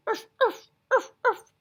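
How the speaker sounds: high, loud barking urfs; seal bark. Retold or imitated. Teacher imitating a seal barking in class, loud high urfs. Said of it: "That's kind of a seal sound".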